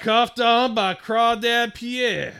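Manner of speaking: high, loud and slow, a put-on Cajun drawl; put-on Louisiana accent, slow and loud; mock Cajun bystander. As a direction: ham up a gossiping Louisiana local.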